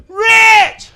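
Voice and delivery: high, full-force scream for help; top-of-lungs scream. Retold or imitated. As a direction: yell the name as loud as possible. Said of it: "screamed to the top of my lungs".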